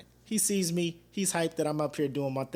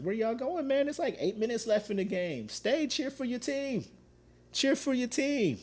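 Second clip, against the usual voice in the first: mocking taunt; 11 semitones higher than usual. Taunting Cardinals fans leaving early, high mocking voice. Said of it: "I did videos like".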